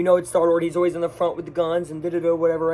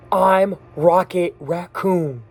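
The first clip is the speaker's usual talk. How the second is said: slow, weighty line delivery; dramatic declaration; much slower than usual. Retold or imitated. Rocket declaring who he is, slow and weighty. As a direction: land each word slowly, like a hero's big moment. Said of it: "and goes".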